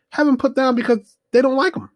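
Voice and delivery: high, fast, mock-exasperated; incredulous. Real, not acted. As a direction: act amazed at how silly the reason is. Mocking disbelief at the parents.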